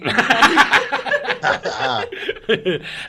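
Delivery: high, loud burst of laughter; big cackling laugh. Real, not acted. Laughs at guest's literal reply.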